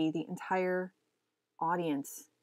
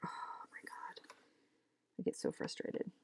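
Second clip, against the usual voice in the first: whispered exasperation; 13 dB quieter than usual. Real, not acted. Admitting how angry gaming makes her, whispered. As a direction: whisper it like a guilty confession.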